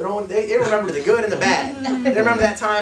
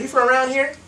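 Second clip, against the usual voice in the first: higher, eager rising question; excited demo; 5 semitones higher than usual. Shown as a demonstration. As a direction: show them how to get excited. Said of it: "Gotta get excited".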